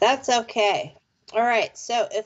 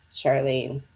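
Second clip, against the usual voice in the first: usual speaking voice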